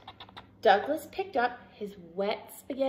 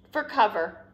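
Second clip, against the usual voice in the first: ordinary delivery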